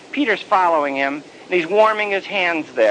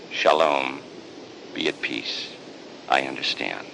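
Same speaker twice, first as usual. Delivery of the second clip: tender Jesus; 12 semitones lower, 7 dB quieter than usual. Acted. Jesus tender, much lower, softer.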